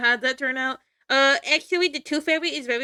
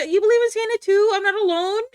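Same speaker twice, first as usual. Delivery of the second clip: excited; 6 semitones higher, 5 dB louder than usual. Real, not acted. Delighted at fellow Santa believer.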